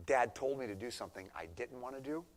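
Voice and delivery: ordinary delivery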